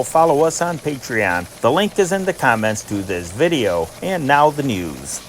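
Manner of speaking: normal talking voice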